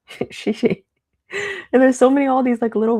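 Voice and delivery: giggling through the word Shishi; amused chuckle on Shishi; laughing. Real, not acted.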